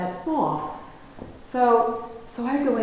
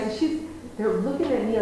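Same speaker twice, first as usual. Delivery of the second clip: ordinary delivery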